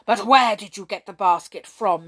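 loud, stern questioning. A narrator's reading. King questioning Hop about the basket, stern. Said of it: "he asked Hop sternly".

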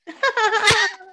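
sudden high-pitched laugh. Real, not acted. Laughs at own question.

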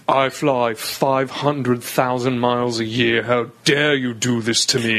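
put-on indignant British accent, slow and low; mock-outraged posh-passenger British accent. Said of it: "was he actually British".

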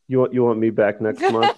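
laughing through the question. Real, not acted. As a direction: giggle as you ask it. Laughing at the invite.

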